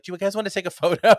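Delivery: imitating Kylie cheerfully offering a photo, high and loud; bright offer. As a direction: offer the photo like a delighted star. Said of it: "she was like".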